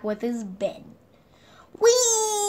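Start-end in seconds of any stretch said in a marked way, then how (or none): 1.8-2.5 higher, louder gleeful whee; shout. Acted. Both friends sliding down the hill, gleeful shout. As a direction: whoop down the hill. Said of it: "they shouted".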